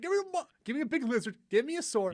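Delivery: very high, loud exasperated shout; mock frustrated yell. Joking about wanting to just fight, high mock yell. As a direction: squeal it like an impatient gamer.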